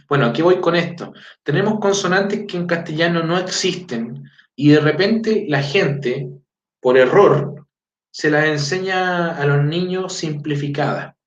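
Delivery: ordinary delivery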